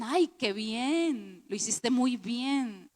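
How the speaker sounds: slow, drawn-out gushing compliment; lightly hammed-up admiration; mock praise. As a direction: imitate an admirer, stretching each word. Imitates admirers praising the speaker.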